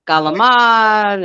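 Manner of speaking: Galamad slow, hard G, no final vowel; drawn-out Galamad, ending clipped on the d; Italian-American pronunciation. Shown as a demonstration. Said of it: "We say galamad".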